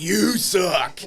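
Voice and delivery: mock heel yelling back at a heckler; retold taunt. Heel wrestler answers hateful fan, retold loud taunt.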